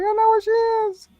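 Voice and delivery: extremely high, tiny squeak; mock squished squeal. Captive about to get squished, squeaky mock confession. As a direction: squeal it as if being crushed small. Same line as being squished.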